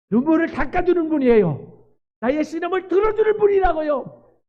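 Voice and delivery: high, loud preacher's cry; fervent preaching. Acted. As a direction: preach it out with full force. Preaching at full force.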